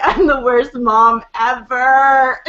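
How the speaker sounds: slow, drawn-out self-pitying wail, half-laughing; hammed-up retold misery; mock despair. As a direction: lament it with comic exaggeration. Retold self-blame at bedtime, laughing.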